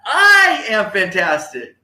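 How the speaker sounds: huge high held I, then slow; loud rally cry. Shown as a demonstration. Speaker leads the audience's final phrase, loud rally cry. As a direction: belt it like you mean it. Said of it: "Say it nice and loud".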